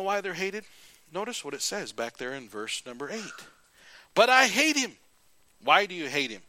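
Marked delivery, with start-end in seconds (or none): 4.1-5.0 high, loud mock outburst; Ahab's outburst. Ahab complaining about the prophet, loud high outburst. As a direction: spit out the grudge.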